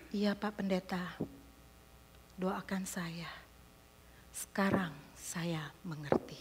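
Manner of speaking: soft whisper of surrender; whispered, humbled reply. Retold or imitated. Humbled father answers pastor. (berbisik lirih penuh penyesalan)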